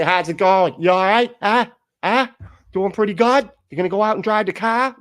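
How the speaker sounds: put-on loud, high Boston accent. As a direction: shout it like a loud Boston guy. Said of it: "Boston guy".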